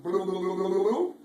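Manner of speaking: loud bouncy blue blue jump noise; playful boing sounds for the jump; jumping sound effect. Acted. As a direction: call out each bounce loudly. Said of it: "like that".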